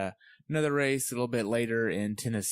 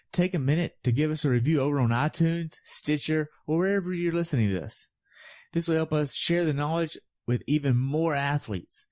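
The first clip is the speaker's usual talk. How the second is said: upbeat, louder announcer read; podcast outro; 4 semitones higher, 4 dB louder than usual. Acted. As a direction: sign off the show like a host.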